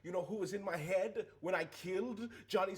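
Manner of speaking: heated confession. Acted. Player in character, emotional confession.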